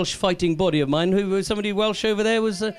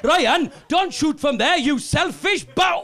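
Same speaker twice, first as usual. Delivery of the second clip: high, slow furious shout; exasperated yell; 6 semitones higher, much slower than usual. Retold or imitated. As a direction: drag it out in outrage. Teammate yelling at selfish Ryan.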